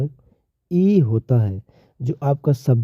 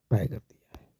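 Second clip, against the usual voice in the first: whispered example word; 10 dB quieter than usual. Real, not acted.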